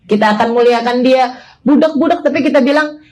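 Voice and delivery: ordinary delivery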